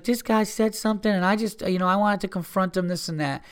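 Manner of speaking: high-pitched imitation of a woman's excuses; mimicking the girl. Mimics girl explaining to cop.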